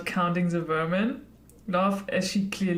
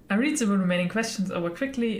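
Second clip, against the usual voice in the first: ordinary talk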